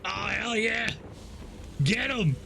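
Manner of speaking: loud, high-pitched cheer; excited cheering. Real, not acted. Streamer rooting for the hero in a fight, excited cheering.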